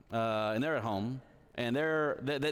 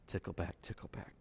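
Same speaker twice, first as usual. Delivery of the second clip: softer and softer, lower; fading to hush; 8 semitones lower, 10 dB quieter than usual. Real, not acted. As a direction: let each tickle back fade away. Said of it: "soothing narration fading".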